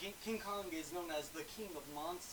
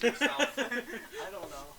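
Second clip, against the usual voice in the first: laughing; 9 semitones higher, 9 dB louder, much slower than usual. Real, not acted. Laughing outburst.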